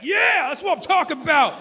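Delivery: high, loud whooping cheer; mock fan cheer; cheering crowd. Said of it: "And they're like".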